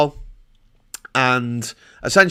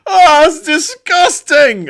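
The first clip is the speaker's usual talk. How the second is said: high, loud, drawn-out mock disgust; disgusted twelve-year-old; 14 semitones higher, 10 dB louder, much slower than usual. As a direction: imitate a revolted child, really stretching it out. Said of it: "she was like".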